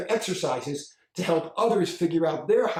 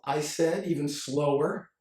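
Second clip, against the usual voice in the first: usual speaking voice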